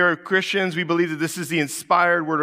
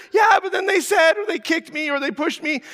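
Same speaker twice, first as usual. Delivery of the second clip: put-on whiny kid; 12 semitones higher than usual. Child listing grievances to dad, high whine fading.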